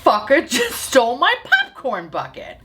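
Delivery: high-pitched mock indignation, laughter breaking in; retold outrage. Grandma on scooter announces the theft, mock outrage. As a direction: imitate an indignant cursing grandmother, amused.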